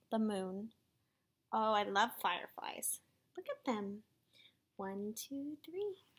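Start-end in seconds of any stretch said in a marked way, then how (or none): 3.4-6.0 soft hushed wonder; awed whisper. Real, not acted. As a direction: whisper it in awe. Reader in awe of fireflies.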